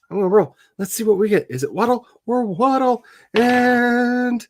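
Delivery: high, excited drumroll delivery; suspenseful build-up. Pretend. Streamer rolls a die for the chat, suspenseful build-up.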